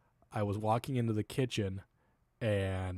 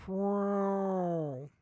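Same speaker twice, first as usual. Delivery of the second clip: much higher, louder drawn-out whoa like a fart; fart noise imitation; 10 semitones higher, 4 dB louder than usual. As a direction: mimic the daughter's long squeaky fart. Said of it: "I heard it and it went".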